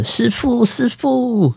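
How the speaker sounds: high-pitched drunk calling out, imitated; mock a drunk hollering at his master; 模仿醉酒大喊; drunken yelling. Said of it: "我就很，很不礼貌的说".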